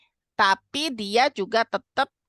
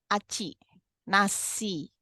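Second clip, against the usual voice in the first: softer, careful model request; without shouting; 6 dB quieter, much slower than usual. Shown as a demonstration. Mother teaching a child to ask for rice, without shouting. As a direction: say it nicely and quietly, word by word. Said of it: "Ajarin untuk mengatakan tanpa teriak".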